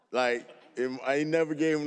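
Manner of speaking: normal talking voice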